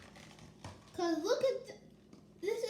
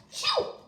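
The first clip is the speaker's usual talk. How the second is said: big high mock sneeze; 6 dB louder than usual. Gerald sneezing near his pig friend, a loud mock sneeze. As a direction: blast out a hammed-up sneeze.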